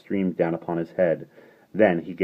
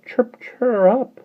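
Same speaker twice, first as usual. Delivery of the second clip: bird chirp; 7 dB louder, much slower than usual. A narrator's reading.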